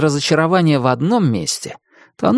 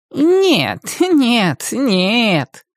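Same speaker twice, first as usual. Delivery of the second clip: mocking echo; 10 semitones higher, much slower than usual. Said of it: "словно издеваясь, эхом повторил голос".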